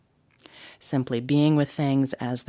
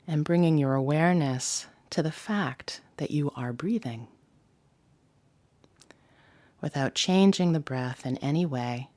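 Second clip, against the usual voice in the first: guided meditation. Acted. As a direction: ease the listener into the body scan gently. Leads the body scan.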